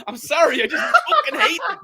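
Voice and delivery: high, squeaky laugh through the words; helpless high-pitched laughter; laughing apology. Real, not acted. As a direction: apologise while cracking up. Apologising after own angry outburst.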